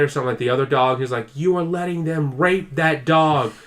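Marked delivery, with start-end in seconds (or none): 1.3-3.5 raised, higher voice, building louder; mock a furious tirade, drawing out the words; imitated angry yelling. Imitating a son fighting with his dad, angry yelling. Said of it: "when he's yelling and fighting with his dad".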